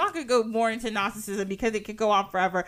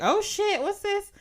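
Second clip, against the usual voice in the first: higher-pitched mock surprise; startled discovery; 10 semitones higher, slower than usual. Two guys digging stumble on something, startled. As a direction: play up the startled find. Acting out the finders' reaction.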